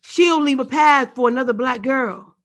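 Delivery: loud, high, ringing recital of the refrain; spoken word poem. Acted. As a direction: proclaim the line to the room.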